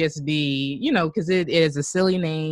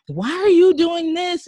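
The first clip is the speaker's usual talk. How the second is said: mock road rage; 7 dB louder than usual. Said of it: "feel such rage while driving".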